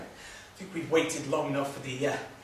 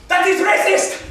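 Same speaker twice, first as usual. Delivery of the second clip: very high, loud accusation; scandalised, at full volume; outraged shout; 12 dB louder than usual. Acted. Frenchman accuses in quarrel.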